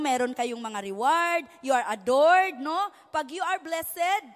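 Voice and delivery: ordinary talk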